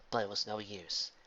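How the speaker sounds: ordinary delivery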